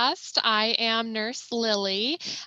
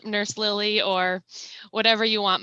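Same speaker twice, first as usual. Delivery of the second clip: normal talking voice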